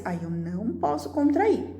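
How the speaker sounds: ordinary talk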